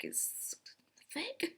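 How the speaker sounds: breathy whisper on thick; whispered aside. Shown as a demonstration. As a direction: lean in and whisper it as a secret. Whispers the repeated punchline.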